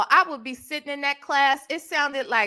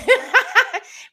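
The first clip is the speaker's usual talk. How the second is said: high burst of laughter; 12 semitones higher, 6 dB louder than usual. Real, not acted. Laughs while recalling mother.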